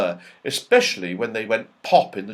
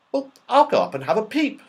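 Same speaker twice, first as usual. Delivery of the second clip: put-on fast, higher, eager boy; 12 semitones higher, 3 dB louder, faster than usual. A narrator's reading. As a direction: jump at the idea. Joe eager.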